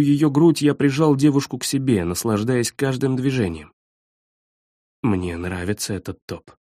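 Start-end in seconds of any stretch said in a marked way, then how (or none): 5.0-6.5 low soft whisper. A narrator's reading. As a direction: murmur it into her neck. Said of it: "шепнул я".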